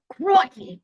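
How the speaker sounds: a loud, high sample of Crikey in Australian; Australian prompt. Shown as a demonstration. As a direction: show him how to say Crikey, loud and high.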